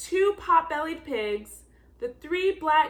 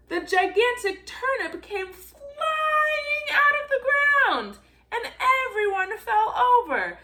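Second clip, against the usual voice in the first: excited telling; 7 semitones higher than usual. A narrator's reading. Telling children everyone fell over, excited. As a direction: let the climax tumble out with glee. Turnip flies out, everyone falls.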